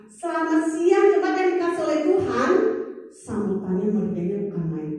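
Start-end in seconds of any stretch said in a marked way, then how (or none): 0.1-2.5 high, loud preacher's welcome; preacher greeting the congregation, high and loud; pulpit greeting. Retold or imitated. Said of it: "saya baru nyapa".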